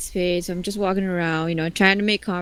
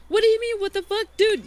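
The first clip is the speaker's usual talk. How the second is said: high, indignant disbelief; incredulous; 12 semitones higher than usual. Retold or imitated. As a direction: throw her words back at her. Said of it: "And I'm like".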